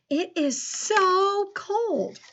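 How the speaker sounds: high, slow It is so cold; excited exclamation. Shown as a demonstration. Teacher repeating the exclamatory dictation sentence, high and slow. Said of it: "Listen to how I say it today".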